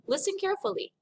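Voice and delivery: ordinary talk, nothing marked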